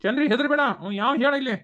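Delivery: high, loud fake reassurance; hammed-up loud comfort; loud mock concern. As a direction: put on a show of protecting her. Man loudly feigns protectiveness.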